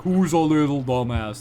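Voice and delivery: light doting owner demo; mock-affectionate dog talk; dog-praising voice. Shown as a demonstration. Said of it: "as long as you go like".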